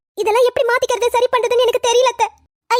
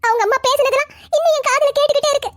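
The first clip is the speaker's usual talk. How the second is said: sobbing through the words; tearful; 3 semitones higher than usual. Acted. Mother telling her son she cannot eat, crying. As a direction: choke it out in a weeping wail. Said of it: "அழுதுகிட்டு இருக்கீங்க".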